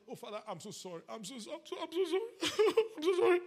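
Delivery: high, stammered put-on crying; hammed-up blubbering, repeating through fake sobs; mock sobbing apology. Preacher imitating tearful sinner before the Father, exaggerated sobbing. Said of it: "That is not repentance".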